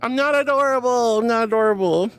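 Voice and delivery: high loud squeal of protest, easing down; mock-indignant protest. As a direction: squeal it out in playful outrage. Protesting chat calling her adorable.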